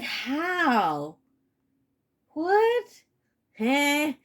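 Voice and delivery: baffled questions, jumping up high; mock disbelief. Asked to trust this person again, mock disbelief. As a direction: act dumbfounded, pitch leaping up.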